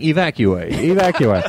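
lightly imitated evacuation recording; alarm announcement voice. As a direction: mimic an automated alarm voice. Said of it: "there's a voice saying".

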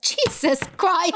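high, drawn-out exclamation; hammed-up emotional outburst; overwhelmed wail. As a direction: wail it slowly, high above normal. Acting out her tearful overwhelmed reaction.

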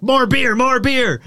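put-on drunken shouting. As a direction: bellow it like a rowdy bar crowd. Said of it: "starting to shout".

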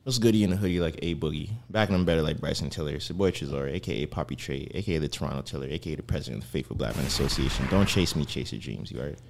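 low, rolling rapper intro; low hype-man intro. Acted. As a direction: drop low and hype yourself.

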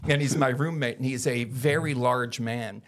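normal talking voice

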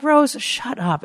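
high, slow, drawn-out "shut up". Retold or imitated. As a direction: brush her off slowly. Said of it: "I'm like".

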